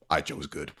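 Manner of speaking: mock-proud after a stunt; proud young hero. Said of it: "and goes".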